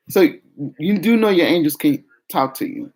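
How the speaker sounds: normal talking voice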